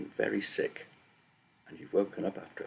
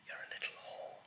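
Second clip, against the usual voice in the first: voiceless whispered snarl, much softer; malevolent snarl; 15 dB quieter than usual. A narrator's reading. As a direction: spit the insult as a sinister whisper. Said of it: "The voice in her bed snarled malevolently".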